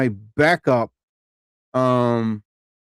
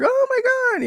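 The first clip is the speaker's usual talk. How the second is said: mock excited gasp; faster than usual. Mimicking excited crowd over Bill Burr.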